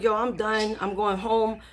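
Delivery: plain reading voice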